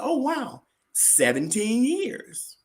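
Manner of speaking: high, drawn-out mock amazement; amazed reaction. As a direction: imitate her wowed reaction, stretching the words. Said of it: "And she laughed".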